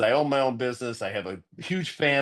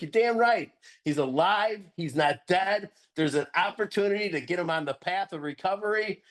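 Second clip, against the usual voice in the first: excited; 6 semitones higher than usual. Retold or imitated. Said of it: "I was like".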